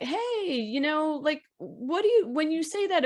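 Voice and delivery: parent gently questioning. Pretend. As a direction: broach it with your son, voice lifted. Voices a parent gently questioning son.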